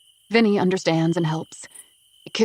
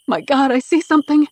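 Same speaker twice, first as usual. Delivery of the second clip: alarmed discovery; 7 semitones higher, 7 dB louder than usual. A narrator's reading. Spots the missing child.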